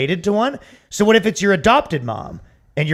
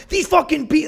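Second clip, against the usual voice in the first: high-pitched mock outrage; exaggerated anger; 11 semitones higher than usual. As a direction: pretend to be madder than you are. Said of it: "even pretend they're more mad".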